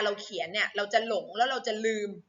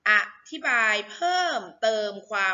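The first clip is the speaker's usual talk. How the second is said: model reading. Shown as a demonstration. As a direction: stretch out each syllable of "อธิบายเพิ่มเติมความรู้". Model reading for pupils to repeat.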